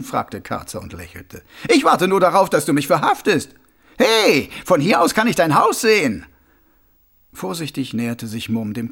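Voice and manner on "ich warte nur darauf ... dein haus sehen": high, loud mocking voice; a smug killer playing innocent; sneering villain. A narrator's reading. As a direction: Carcer mocking the watchman. Karzer mocks.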